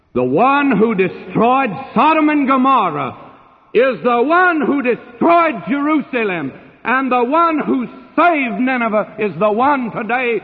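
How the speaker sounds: high, ringing pulpit crescendo; emphatic preaching. Real, not acted. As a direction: drive each parallel phrase home from the pulpit. Preacher thunders the climax of his argument.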